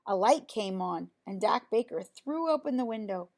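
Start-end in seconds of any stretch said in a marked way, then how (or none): none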